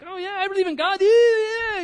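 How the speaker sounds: high, dreamy mock voice of a stoned man; mock spaced-out guy. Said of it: "you know, like, weird".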